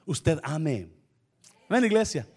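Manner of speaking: ordinary talk, nothing marked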